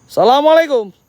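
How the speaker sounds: loud high call into the dark; greeting shouted across the graves. Real, not acted. Calling out at night cemetery.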